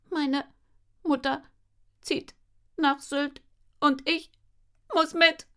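robot voice. Acted. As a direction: say it like a flat robot. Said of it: "mit Roboterstimme".

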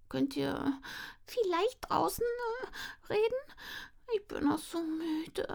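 put-on high, soft, tired child voice; sleepy little sister. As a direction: plead it, half asleep.

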